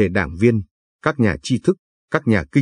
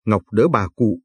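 usual speaking voice

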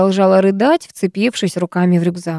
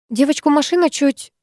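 a touch of a flustered babble, higher; girl babbling to her teacher after the near accident; 7 semitones higher than usual. A narrator's reading. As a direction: stumble over it, shaken. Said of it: "залепетала я".

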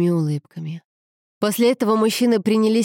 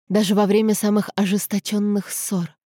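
usual speaking voice

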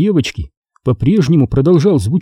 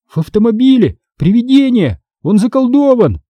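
high, urgent bursts; blurting out; 9 semitones higher than usual. A narrator's reading. Boy confessing about the ghost, blurted out high. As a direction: blurt each phrase in a raised pitch. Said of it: "выпалил я".

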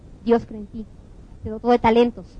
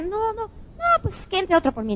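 nervous refusal; 8 semitones higher, slower than usual. Retold or imitated. Coach imitates the fearful player.

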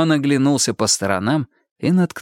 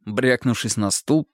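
plain reading voice

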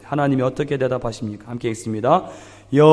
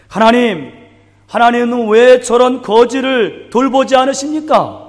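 raised, indignant cry to God, louder and higher; indignant outcry; 7 semitones higher, 5 dB louder than usual. Retold or imitated. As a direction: cry out to God in bitter anger at injustice. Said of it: "울분을 토하면서 외치기를".

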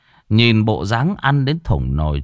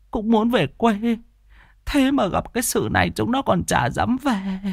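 put-on high, tearful complaint of an old woman; whimpering old woman; 11 semitones higher than usual. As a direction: whine it out, on the verge of tears, pitched high. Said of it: "mếu máo". (giọng bà cụ mếu máo, cao và tủi thân)

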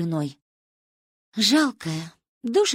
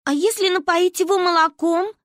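put-on high, small girl's squeak; high-pitched childish suggestion; squeaky child; 11 semitones higher, 5 dB louder than usual. A narrator's reading. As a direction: pipe up with a timid idea. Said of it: "пискнула я".

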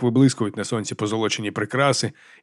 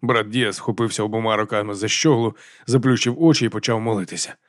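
usual speaking voice

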